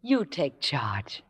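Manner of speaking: slow breathy whisper of surrender; soft, slow whispered yielding. Acted. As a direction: hand him control in a hush. Intimate invitation to supper.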